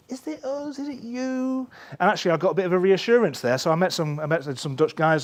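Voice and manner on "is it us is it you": much higher, thin and softer, pleading; anxious squeaky question. Retold or imitated. Speaker to strangers in Copenhagen, high anxious plea. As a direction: ask it in a small worried high voice. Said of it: "talked to some complete strangers and went".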